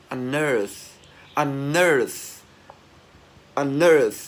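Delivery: "unearth" punched out with a breathy TH ending; loud model of "unearth", ending fully released; released final TH. Shown as a demonstration. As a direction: push air through the TH closing "unearth". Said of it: "cái âm T H mà chúng ta bật hơi ra".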